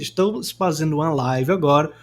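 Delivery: ordinary delivery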